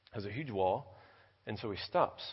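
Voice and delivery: normal talking voice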